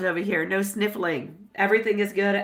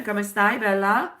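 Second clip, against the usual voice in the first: usual speaking voice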